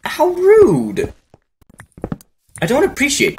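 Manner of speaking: very high, prissy indignation; mock offended. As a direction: play the insulted pig. Mock offended pig reply.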